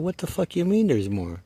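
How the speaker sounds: high, shocked swearing; shocked outburst. Retold or imitated. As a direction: blurt it out in disbelief. Retold shocked reaction.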